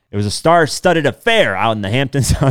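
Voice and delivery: reading a gossip article aloud; bright, punchy news-anchor read. Acted.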